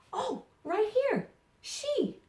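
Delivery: playful pretend amazement for a young learner; mock delighted surprise. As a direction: act thrilled the word was found. Pretends child found word.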